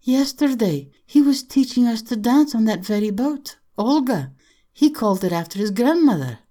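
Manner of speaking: put-on high, lively girl's voice in dismay; distressed young girl. Young girl remembers dancing on that very boat, distressed. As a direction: recall it in a high, upset voice.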